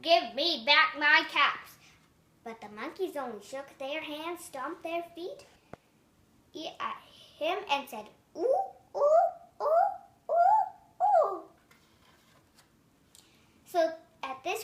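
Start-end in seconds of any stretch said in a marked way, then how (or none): 0.0-1.8 loud, angry demand; furious peddler yells up at the monkeys; yelled. A narrator's reading. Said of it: "stomped his feet and yelled". 8.4-11.4 very high, loud monkey ooh-ooh calls; monkey hoots. Acted. Monkeys mimic the angry peddler.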